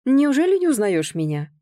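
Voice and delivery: high, mock-offended question; feigned indignation. A narrator's reading. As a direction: act affronted for show. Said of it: "он притворно возмутился". (притворно возмущённо)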